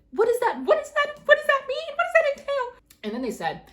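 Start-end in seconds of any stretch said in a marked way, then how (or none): 0.1-2.6 much higher, louder incredulous questions. Real, not acted. Applicant venting about a vague admission email, incredulous high questions. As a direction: demand answers in disbelief.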